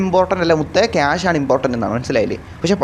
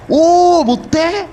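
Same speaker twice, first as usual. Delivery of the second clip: surprised cry; 13 semitones higher, 5 dB louder, much slower than usual. Real, not acted. Spots the bus lettering.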